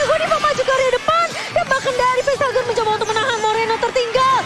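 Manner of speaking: high, loud hype call; fighting game announcer. Acted. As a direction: build the play-by-play to a peak. Hyped play-by-play climax.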